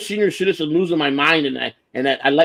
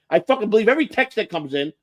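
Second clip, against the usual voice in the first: ordinary talk, nothing marked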